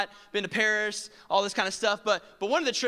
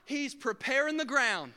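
ordinary delivery

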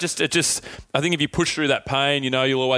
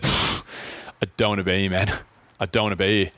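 understated, low-pitched reluctance; retold dejection; 5 semitones lower than usual. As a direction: quote yourself worn down, pitch dropped and dragging. Retells telling his mate while struggling.

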